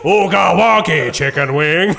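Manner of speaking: hammed-up alien slob yell, drawn out slowly; a laughing mock creature shout; Jabba the Hutt. Mate imitating a sprawled Jabba grunt, loud and slow. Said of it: "like, fucking Jabba the Hutt".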